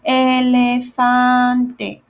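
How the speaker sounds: "Elefante" drawn out, final E clearly sounded; slow final E. Shown as a demonstration. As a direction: stretch "Elefante" and ring the last E. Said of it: "you always add, like, an E at the end".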